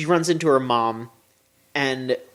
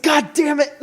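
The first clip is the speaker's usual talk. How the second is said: laughing mock curse; 12 semitones higher than usual. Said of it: "It's like".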